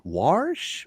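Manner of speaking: "warsh" drawn out with an r; dialect WARSH. Shown as a demonstration. Host repeats the dialect word, "warsh" with an r. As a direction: stretch "warsh", r before the sh. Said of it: "We also say".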